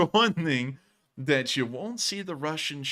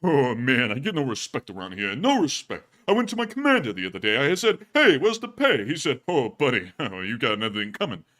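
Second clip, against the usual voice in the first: put-on sad-sack soldier moaning about no respect; grumbling soldier voice; 2 semitones lower than usual. Soldier grumbling to viewers, mock woe-is-me comic voice. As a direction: whine it like a hard-luck comic. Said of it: "they're going".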